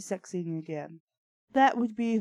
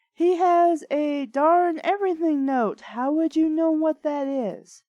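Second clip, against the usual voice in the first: mock British accent; 7 semitones higher, 4 dB louder than usual. British voices continue.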